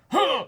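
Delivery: sharp high gasp of delight; high, delighted little gasp; imitated gasp. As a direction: gasp like your heart just melted. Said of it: "his face that he's doing right here is the".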